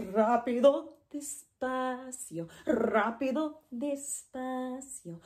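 brisk rápido, stretched despacio; fast versus slow. Shown as a demonstration. Teacher contrasting rápido and despacio by pace. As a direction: alternate quick and drawn-out words. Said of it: "fast and slow".

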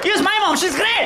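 high, loud, showy boast; mock brag. Rude guest loudly recommending his mom, high mock shout. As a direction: push your own mom loudly. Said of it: "And so like".

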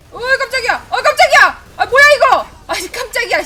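high, loud jolts of fright; startled loud exclamations. Real, not acted. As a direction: yelp at the sudden ambush. Startled by a sudden enemy.

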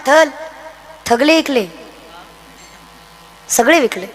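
थगले with th for s, low and soft, a shy lisp; lisping boy. Retold or imitated. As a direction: mimic the boy's lisp, quietly. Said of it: "पोरगा म्हणला".

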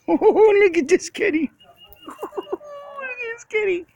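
high, loud squeal of delight at the kitty, breaking into a laugh; very high excited coo. Real, not acted. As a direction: gush over the cat, laughing. Delighted by a strange cat.

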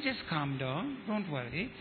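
low, soft reassurance; calming. Retold or imitated. Preacher settles an angry man on the street, calming. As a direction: soothe him, voice dropped right down.

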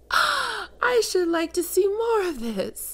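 high, slow, delighted purr of glee. Acted. Succubus thrilled by her flustered pet, high and delighted. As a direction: savour the discovery, voice lifted high.

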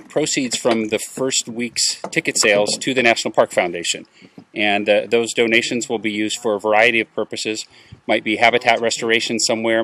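ordinary talk

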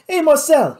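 loud, high call; demanding. A narrator's reading. Louis grabbing the nearest Cajun, demanding. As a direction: call him out sharply. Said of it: "he demanded".

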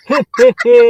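loud forced heh heh heh; short punchy fake chuckle; mock laugh. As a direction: put on a hammy laugh at the joke. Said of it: "হাসালা তুমি গো".

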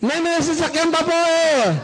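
put-on high, slow, laughing little boy's excuse; child's excuse. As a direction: whine it like a kid caught dawdling. Child answering his mother.

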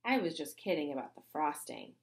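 plain reading voice

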